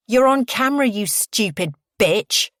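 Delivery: loud, high outburst, slowing on the insult; furious, emphatic accusation; angry snap. A narrator's reading. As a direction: finally lose patience and lash out. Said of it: "I finally snap".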